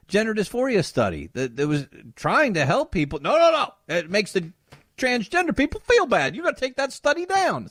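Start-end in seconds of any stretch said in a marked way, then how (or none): 3.2-7.7 higher, whiny mock protest; mock activist outcry. Host mocking activists demanding the study come down, whiny. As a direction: send up the offended activist.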